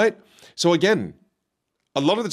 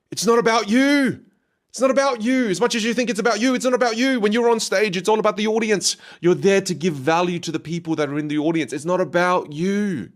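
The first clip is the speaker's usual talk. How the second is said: higher-pitched, impassioned urging; emphatic insistence; 8 semitones higher than usual. Real, not acted. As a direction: drive the point home to the audience, high and insistent. Emphatic coaching outburst on stage.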